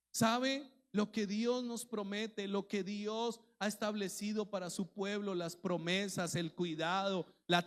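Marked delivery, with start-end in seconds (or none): none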